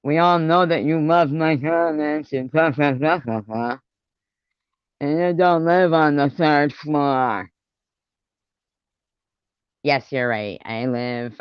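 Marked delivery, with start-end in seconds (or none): 0.0-7.5 sing-song parody of critics; mocking quote. Parodying critics' comments, lightly mocking. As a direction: mimic the gossiping critics. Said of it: "These bitches going around".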